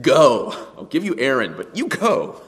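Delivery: loud, stern order; angry command. Retold or imitated. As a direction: bark the command at him. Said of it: "God's response was anger".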